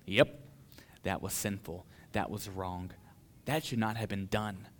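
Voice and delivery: low, soft private acknowledgement; quiet self-admission. Acted. Preacher acknowledging his sin to himself, quiet self-admission. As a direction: own up to yourself, soft and low. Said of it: "acknowledging to myself".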